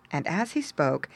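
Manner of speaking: plain reading voice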